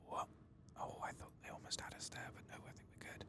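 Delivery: hushed, unvoiced whisper; sneaky whisper. Player whispering to viewers while sneaking past Twilight Binders, hushed whisper. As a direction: whisper it as if creeping past someone.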